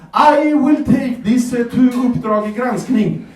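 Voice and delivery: put-on high, slow, huffy old lady threatening to report; mock outrage, drawn out and lifted; angry customer threatens a TV exposé, hammed-up high voice; indignant woman. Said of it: "She was like".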